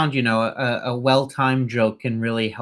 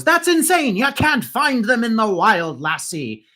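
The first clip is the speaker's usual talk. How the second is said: high, loud leprechaun exclaiming; 9 semitones higher, 3 dB louder than usual. Acted. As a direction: squeak it out like an indignant leprechaun. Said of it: "And the leprechaun goes".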